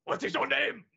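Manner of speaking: mock a weird dubbed voice; put-on gruff voice, clashing with a nice smile. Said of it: "Jet Li's weird dubbed voice".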